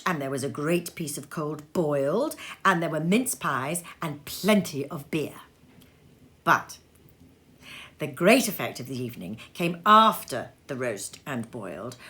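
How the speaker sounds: usual speaking voice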